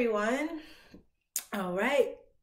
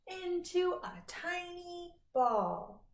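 tiny voice; 5 semitones higher than usual. Shown as a demonstration. Shrinking small.

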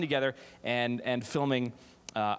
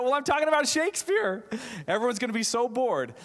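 much higher, louder mock panic about boring them; 10 semitones higher, 3 dB louder than usual. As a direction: squeal it like a worried thought. Panicked inner thought, much higher.

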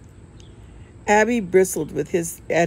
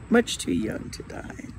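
whispered, a little higher and much softer; sad whisper; 5 semitones higher, 5 dB quieter than usual. A narrator's reading. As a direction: breathe it out as a sorrowful aside. Sad man repeats, corrected.

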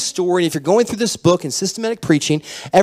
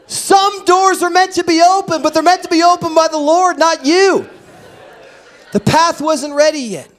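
much higher, louder impassioned preaching; preaching at full force; 10 semitones higher, 3 dB louder than usual. Acted. Pastor exhorting congregation at the climax, impassioned. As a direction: drive the point home to the congregation.

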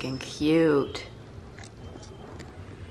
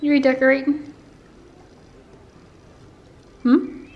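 high teasing question; higher pet voice; 7 semitones higher, 6 dB louder than usual. Real, not acted. Asking the cat about redecorating, high and louder. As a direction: raise it up and ask brightly.

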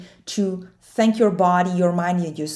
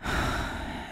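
long, soft audible exhale; breathing out; 9 dB quieter than usual. Shown as a demonstration. Coach reminding listeners to breathe, soft audible exhale. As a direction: let the breath out slowly and quietly. Demonstrates breathing during meditation.

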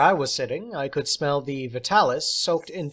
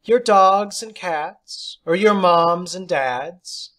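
high, louder, slow teacher; slow teasing teacher; 5 semitones higher, 7 dB louder, slower than usual. Acted. Teacher teasing the class, slow, high and loud. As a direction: draw it out teasingly.

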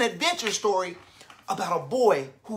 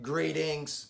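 low, soft robot greeting; 5 semitones lower, 7 dB quieter than usual. Acted. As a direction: speak it like a gentle robot. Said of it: "the robot says in his robot voice".

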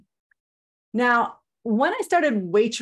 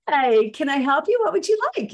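high, bright-on-purpose sugary waitress greeting, louder; hammed-up fake kindness, pitched way up on the question; fake sweet voice; 6 semitones higher, 5 dB louder than usual. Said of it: "in my fakiest kind voice".

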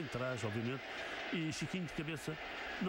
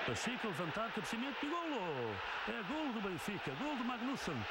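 high, soaring shout of a goal; excited goal call; 5 semitones higher than usual. Real, not acted. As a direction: roar the goal for the crowd. Goal call in live commentary.

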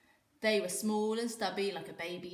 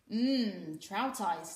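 sneering bully; 5 dB louder, much slower than usual. A narrator's reading. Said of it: "sneered a bigger boy".

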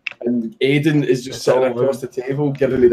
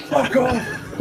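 mock shouting; 9 semitones higher, 4 dB quieter than usual. Imitating the guy outside the chippy, mock shouting. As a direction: imitate an angry passer-by yelling from afar. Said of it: "and, like, shouting at us".

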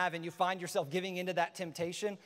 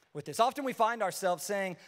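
ordinary talk

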